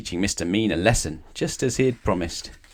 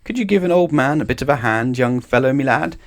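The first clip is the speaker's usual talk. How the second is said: higher, louder call across the garden; 6 semitones higher, 4 dB louder than usual. Acted. Old man calling to Mister Mean from his garden, calling out. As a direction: call to someone passing by. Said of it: "called out".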